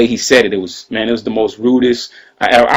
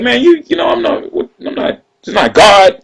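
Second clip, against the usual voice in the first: higher, squeaky hammed-up indignation; mock outraged rant; 12 semitones higher than usual. Imitating Young Jock ranting that Jordan isn't God, in a squeaky, hammed-up voice. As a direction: play it as a squeaky, hammed-up protest.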